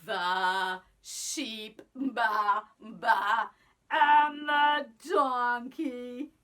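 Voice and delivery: put-on lively sing-song storytelling for kids; reading to children. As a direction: tell it like a picture book to a class. Teacher reads story to children.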